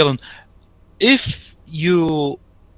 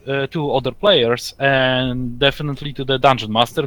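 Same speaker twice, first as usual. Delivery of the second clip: ordinary talk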